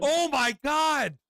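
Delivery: real high, drawn-out shriek of horror; shocked outcry. Real, not acted. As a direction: cry out loud and slow, aghast. Host reacts to bitten-off lip.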